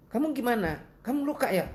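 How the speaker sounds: high-pitched friendly asking; caring, high-voiced questions; friendly concern. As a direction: imitate a kindly check on someone hurt, voice well up. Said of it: "ramah ya, ramah terhadap yang laki".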